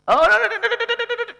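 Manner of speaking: high, rapid repeated no; playful refusal. Acted. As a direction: squeal it out teasingly. Mock refusal, high chant. (menolak bercanda dengan nada tinggi)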